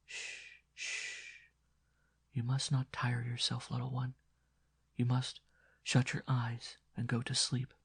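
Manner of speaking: put-on nun soothing a sick boy, hushed; low hushing voice. A narrator's reading. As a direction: hush him gently to sleep. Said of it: "as she replied in a low voice".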